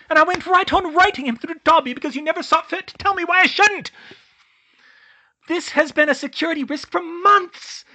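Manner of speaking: rapid, high, loud furious outburst; words pouring out. A narrator's reading. Hermione unloading every grievance on Dumbledore, loud high fury. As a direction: pour it all out at him, high and shouting. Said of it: "The words poured out of her".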